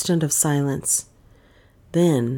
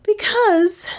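a much higher, strained "Because,"; Dale hedging. Acted. Dale cornered by the detective, answering much higher. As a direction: stall on the word, pitched way up.

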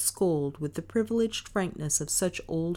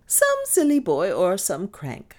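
louder, higher dismissal; firm; 6 semitones higher, 6 dB louder than usual. A narrator's reading. Mistress waving away the threatening letter, firm. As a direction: dismiss it firmly. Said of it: "Miss Cornelia's voice was firm".

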